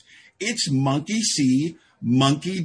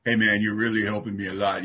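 imitated grateful newcomer; 5 semitones lower than usual. Acts newcomer thanking his sponsor.

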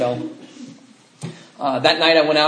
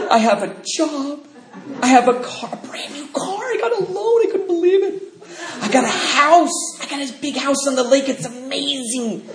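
mocking a smug believer; 12 semitones higher than usual. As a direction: gush like a show-off blessed by God. Mocking a smug sober member.

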